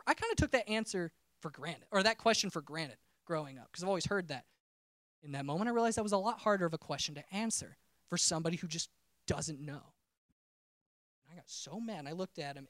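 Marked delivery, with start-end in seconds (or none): none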